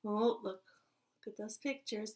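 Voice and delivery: usual speaking voice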